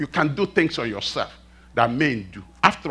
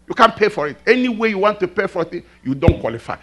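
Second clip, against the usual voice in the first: normal talking voice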